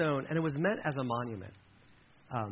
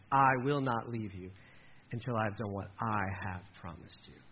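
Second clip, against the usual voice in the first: quieter and lower, gentle and sincere; soft tender promise; 6 semitones lower, 4 dB quieter than usual. Acted. God softly promises Jacob he won't leave him. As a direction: let the promise land quietly.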